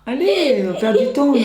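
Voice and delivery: laughing loudly. Real, not acted. Laughing, urging restart.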